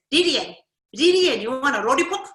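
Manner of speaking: loud Lillian with r sounds for the l; Japanese-accent English, r for l. Shown as a demonstration. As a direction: swap the l's for r's loudly. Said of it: "it would sound like this".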